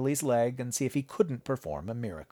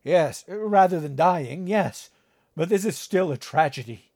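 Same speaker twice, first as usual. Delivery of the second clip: distraught father; 6 semitones higher, 5 dB louder than usual. Acted. Father answering Jessica, anguished. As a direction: grieve over the injury in earnest. Father grieving over leg.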